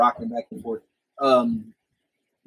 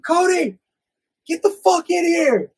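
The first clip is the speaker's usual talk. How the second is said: high, loud mom yelling for her son; imitated scream; 6 dB louder, slower than usual. Friend's mom summons him from the other room, screaming. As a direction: holler it like a mother from another room. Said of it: "His mom screaming from the other room".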